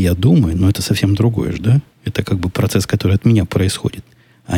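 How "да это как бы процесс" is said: usual speaking voice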